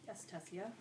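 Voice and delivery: usual speaking voice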